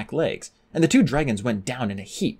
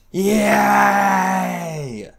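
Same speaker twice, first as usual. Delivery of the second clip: long loud cheering yell; crowd cheering; 8 semitones higher, 5 dB louder than usual. A narrator's reading. Crowd cheering Fjord's wound, loud. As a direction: cheer at the blood. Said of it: "shouted the crowd".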